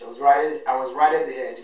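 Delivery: ordinary talk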